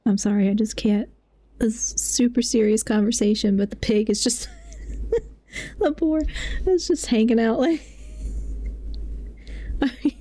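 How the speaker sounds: usual speaking voice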